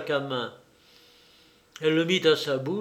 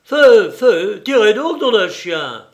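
screaming in rage, much louder and higher; furious yelled order; furious shout; 5 semitones higher, 12 dB louder than usual. Acted. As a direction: yell at the men to fire, stamping with anger. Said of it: "Béchou trépigna de colère".

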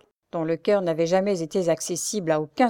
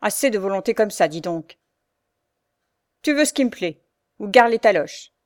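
menacing; 3 semitones higher, 6 dB louder than usual. A narrator's reading. Bully threatening Pierre with blows, menacing. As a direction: bark the threat without a smile. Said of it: "sans rire cette fois".

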